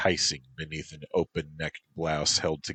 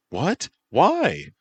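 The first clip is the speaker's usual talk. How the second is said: high, sharp surprised questions; startled; 11 semitones higher, 8 dB louder than usual. Acted. Mike reacts in surprise to Hildy's plan. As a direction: blurt it out in alarm.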